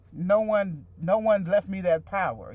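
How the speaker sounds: normal talking voice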